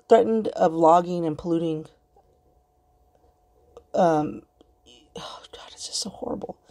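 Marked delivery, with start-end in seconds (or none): 5.2-6.6 breathy whisper of distress; whispered dismay. Real, not acted. Vlogger recalling the film, whispering in dismay. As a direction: whisper it, overwhelmed.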